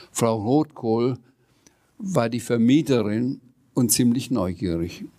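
plain reading voice

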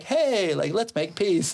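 high, sing-out mock greeting; chirpy peace offering. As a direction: hail the neighbours with playful friendliness. Frolicking to neighbours, offering peace.

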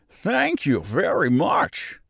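high, slow, hearty thanks; grateful straw man in a high voice; relieved scarecrow. A narrator's reading. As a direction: thank her with drawn-out relief. Scarecrow freed from the pole.

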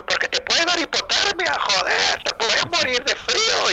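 voz aguda que advierte, algo lenta; amigo español advirtiendo. Retold or imitated. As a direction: imita al amigo preocupado.

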